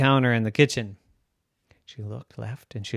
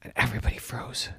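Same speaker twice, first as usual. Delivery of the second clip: whisper; 3 dB quieter, faster than usual. A narrator's reading. Suspense narration.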